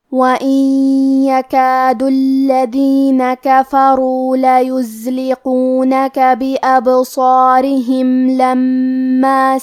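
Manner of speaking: slow, drawn-out melodic tilawa; slow chanted Quran recitation; Quranic recitation. Acted. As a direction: recite the verse slowly, as in prayer. Teacher recites the verse first.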